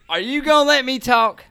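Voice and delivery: put-on loud, high drunken Southern protest; drunk Southerner. Drunk passenger to interrupting driver, loud Southern protest. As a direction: cut in loudly as a Southern drunk. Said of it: "stuck in the Southern accent".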